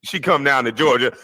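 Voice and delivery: usual speaking voice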